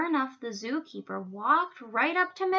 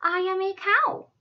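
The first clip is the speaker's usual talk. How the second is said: higher, louder cow voice; hopeful cow; 8 semitones higher, 6 dB louder than usual. A narrator's reading. Miss Moo answers the giraffes, hopeful cow voice. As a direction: introduce yourself as a story cow.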